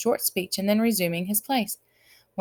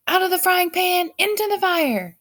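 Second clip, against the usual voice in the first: high, loud shout; 12 semitones higher, 5 dB louder than usual. A narrator's reading. Count shouting after burning himself badly. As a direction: yelp it as he burns himself. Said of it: "shouted the count".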